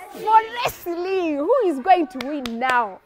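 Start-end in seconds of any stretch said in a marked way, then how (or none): 0.1-1.4 building suspense before the big fight; slow ring announcer. Acted. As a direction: stretch out for wrestling like a ring announcer.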